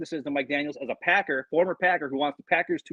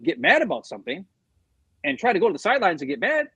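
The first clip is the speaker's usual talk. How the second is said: ordinary delivery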